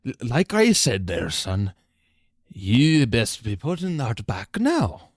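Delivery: menacing shopkeeper. Acted. Shopkeeper threatening player.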